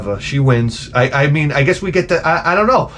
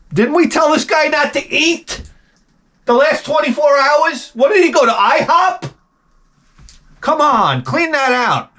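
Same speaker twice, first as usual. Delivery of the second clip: high-pitched, incredulous complaint; exasperated medical staff; 9 semitones higher than usual. Acted. Surgical staff grumbling over a patient who ate, exasperated. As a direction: act out staff griping about the patient.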